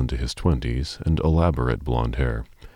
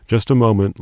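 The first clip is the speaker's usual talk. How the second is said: much higher, louder call; screamed back; 9 semitones higher, 7 dB louder than usual. A narrator's reading. Letty calling back to her sister over the crowd, loud. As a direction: shout it back over the counter. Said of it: "Letty screamed back".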